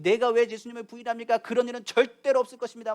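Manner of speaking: high, loud protest; acted vehement refusal; indignant denial. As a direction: push back forcefully, as if wrongly accused. Acts out Peter's indignant denial.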